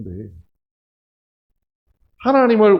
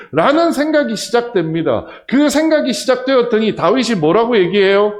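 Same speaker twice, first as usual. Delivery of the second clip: loud, high pulpit preaching; full-force preaching; 7 semitones higher, 8 dB louder than usual. Acted. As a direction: drive it home to the congregation.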